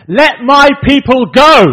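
very loud, high, slow proclamation; commanding shout. Retold or imitated. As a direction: bellow the demand at a ruler, word by word. Moses and Aaron confront Pharaoh.

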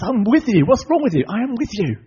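high, loud, acted exasperation; exasperated anger. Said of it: "God actually gets quite angry with him".

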